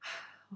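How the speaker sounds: soft weary sigh. A narrator's reading. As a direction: let out a tired sigh. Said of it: "Oz sighed".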